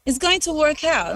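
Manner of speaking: 높게 올린 목소리로 흉내 낸 남편의 안심시키는 말; imitating husband's reassurance. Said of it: "And he's like".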